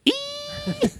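short, very high squeal; mock scream. Banshee flying through the sky, high mock screech. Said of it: "just going".

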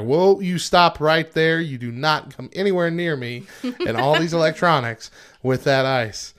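stern warning. Retold or imitated. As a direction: halt him firmly. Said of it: "I'm like".

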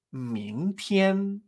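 "mingtian" rising, then high and level; teacherly "mingtian", second tone then first; tones two-one. Shown as a demonstration. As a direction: draw out "mingtian", climbing to a held high note. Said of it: "El tono dos uno".

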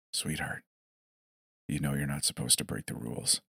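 soft, low whisper in the ear. A narrator's reading. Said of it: "He leaned into my ear and whispered".

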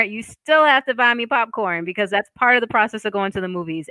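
ordinary talk, nothing marked

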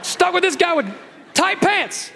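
high whiny disappointed gripe; mock complaint. Churchgoer looking at the guest preacher, high mock whine. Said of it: "you looked at me and you go".

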